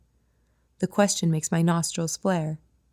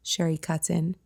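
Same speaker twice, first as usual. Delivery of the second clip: ordinary delivery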